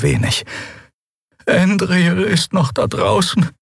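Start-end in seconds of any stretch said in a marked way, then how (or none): 1.5-3.5 much higher, slow plaintive voice. Acted. Xea fears for Endriel outside, plaintive wail. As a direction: lament it, worried for a friend. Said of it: "begleitet von einem klagenden Laut".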